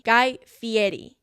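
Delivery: slow, deliberate Guy Fieri with the Italian-style ending; careful slow Guy Fieri. Shown as a demonstration. Teaching listeners the real Guy Fieri, slow and careful. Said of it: "that is how he says it".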